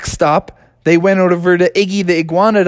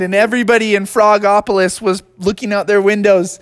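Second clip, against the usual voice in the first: usual speaking voice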